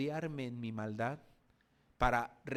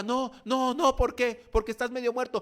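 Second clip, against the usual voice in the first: Samaritan insisting; 9 semitones higher, 10 dB louder than usual. Acted. Acted dialogue on the road.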